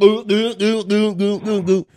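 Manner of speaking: mimicking someone chugging a bottle; guzzling noise. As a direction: make rhythmic glug-glug drinking sounds. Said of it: "guzzles like".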